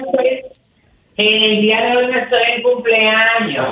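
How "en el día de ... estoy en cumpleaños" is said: said again louder and clearer; louder repeat. Real, not acted. Repeating louder for a friend who could not hear. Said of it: "No se oye bien".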